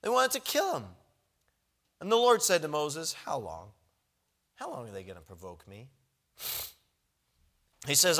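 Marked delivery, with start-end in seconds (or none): 3.1-5.9 weary low God voice. Retold or imitated.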